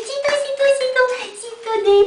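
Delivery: high, quick sing-song coo. Real, not acted. Owner cooing high at each puppy in turn. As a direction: chirp it up high at each pup.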